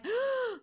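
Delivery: sharp, high gasp for air; sudden re-enacted gasp, as if hit by cold; breathless gasp. Retelling the freezing climb to the attic, breathless gasp. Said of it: "I can't catch my breath".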